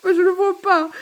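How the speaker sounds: high-pitched, laugh-choked voice; words lifted high by laughter; crying with laughter. Real, not acted. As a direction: relapse into giggles as you speak. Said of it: "On peut pleurer de rire aussi".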